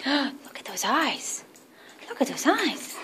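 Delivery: soft, breathy-hushed marvel at the eyes; hushed awe. Real, not acted. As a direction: murmur it in quiet wonder. Awed by the dog's glowing eyes.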